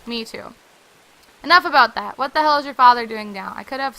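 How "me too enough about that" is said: ordinary delivery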